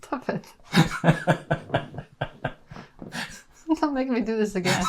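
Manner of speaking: giggling through the words; can't stop laughing. Real, not acted. Giggling fit while filming outro.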